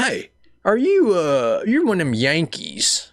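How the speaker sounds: slow, high, loud suspicious questioning; imitated Southern buddy. High school buddy accusing him, slow mock voice. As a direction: draw it out like a nosy good old boy.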